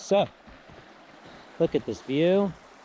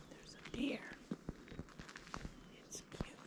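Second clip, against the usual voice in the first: wildlife watching whisper; 10 semitones higher, 20 dB quieter than usual. Real, not acted. Spotting a deer, whispering.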